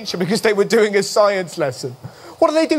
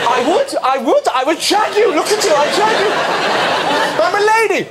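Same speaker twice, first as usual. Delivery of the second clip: higher, hammed-up flirting lady; mock lady voice; 7 semitones higher than usual. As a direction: camp it up as a preening lady. Acting as a man posing as lady.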